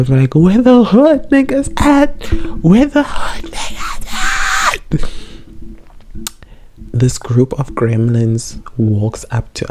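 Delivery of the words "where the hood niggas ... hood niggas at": very high, slow yell in a put-on voice; high, stretched-out street shout; full shout. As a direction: holler it down the whole street. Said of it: "We yelled just so far".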